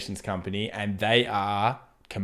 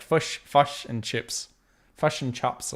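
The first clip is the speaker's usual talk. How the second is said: "Fush, fush and chips" with the Kiwi short i, slow and higher, teasing New Zealanders; slow, higher mock Kiwi accent, vowels in "fush" and "chops" flattened; 4 semitones higher, slower than usual. Aussie streamer teasing Kiwis to his viewers, mock Kiwi accent. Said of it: "Kiwi accent, if I said fish and chips".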